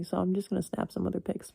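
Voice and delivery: normal talking voice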